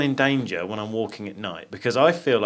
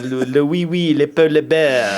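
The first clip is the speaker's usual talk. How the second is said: louder, halting fake French; mock French accent; 3 semitones higher, 4 dB louder than usual. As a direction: fumble through gibberish in a French accent.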